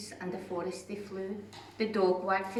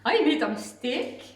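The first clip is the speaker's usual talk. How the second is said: spluttering dragon; 8 semitones higher, 8 dB louder than usual. Acted. Scared dragon apologising to the beast, high and spluttering. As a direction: stammer it out in fright. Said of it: "he spluttered".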